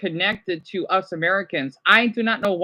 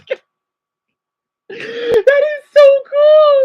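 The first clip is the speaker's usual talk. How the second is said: very high, loud, slow, near tears; squealing delight; 8 dB louder, slower than usual. Real, not acted. Vlogger overjoyed by Azerbaijan float, tearful high squeal. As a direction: gush like you can't contain it.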